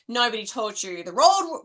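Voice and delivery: ordinary talk, nothing marked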